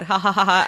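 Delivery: spoken-out stoned chuckle; put-on ha-ha laugh; mock laugh. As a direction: imitate her laughing at herself. Voicing her high realization.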